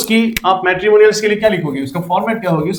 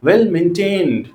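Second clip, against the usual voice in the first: slow dictation; 2 semitones lower, much slower than usual. Shown as a demonstration. Teacher dictating ad wording.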